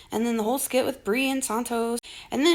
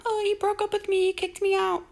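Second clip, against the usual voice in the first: mocking quote; 8 semitones higher than usual. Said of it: "talking about".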